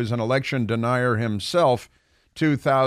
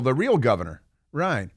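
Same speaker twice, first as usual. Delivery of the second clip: usual speaking voice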